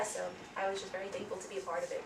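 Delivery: ordinary delivery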